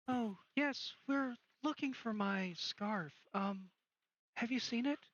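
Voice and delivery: put-on high-pitched ghost girl; Yella. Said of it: "Yella comes out, and she's like".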